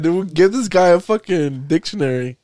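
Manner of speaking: normal talking voice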